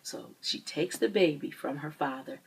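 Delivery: ordinary talk, nothing marked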